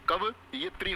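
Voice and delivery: कवियत्री slowly split as "kav... yitri"; correct form, broken up. Shown as a demonstration. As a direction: slowly sound out कवियत्री in two parts, kava and yitri. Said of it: "होता है भाई".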